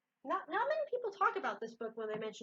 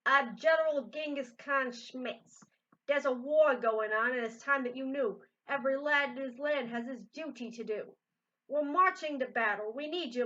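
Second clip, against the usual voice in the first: bellowing general; 4 semitones higher, 9 dB louder than usual. Acted. General recruits a boy into war, bellowing. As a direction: play the pompous general calling up a boy. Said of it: "He bellowed".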